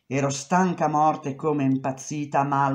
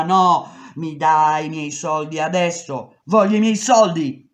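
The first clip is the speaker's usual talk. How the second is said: angry demand; 8 dB louder than usual. Retold or imitated. She demands her pay.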